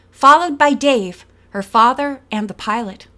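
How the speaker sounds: usual speaking voice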